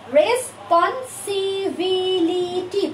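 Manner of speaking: Responsibility slow and drawn out, syllable by syllable; broken into syllables. Shown as a demonstration. Teacher showing students how to memorise, responsibility broken into syllables. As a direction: stretch each beat of responsibility separately. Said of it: "এভাবে ভেঙে ভেঙে শিখলে".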